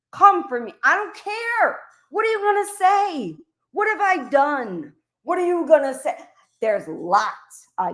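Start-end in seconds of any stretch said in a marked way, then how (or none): 0.1-5.9 heated challenge. Real, not acted. Furious streamer daring a rival to come for her, high and heated.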